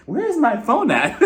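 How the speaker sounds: high, slow, laughing, puzzled; like she is hunting for a buzzing phone; confused searching. Retold or imitated. Vlogger recapping the show, acting out looking for her phone, high and puzzled.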